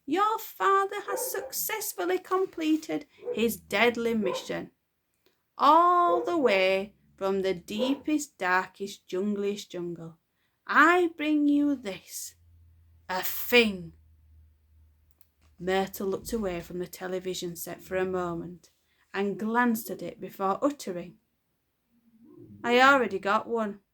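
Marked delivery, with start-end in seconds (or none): 0.0-13.9 high, slow, booming proud father; hammed-up heroic dad; pompous father. Father's grand announcement. 22.6-23.8 put-on loud, blunt girl voice; a hint of a surly child; bored girl. Myrtle's flat reply.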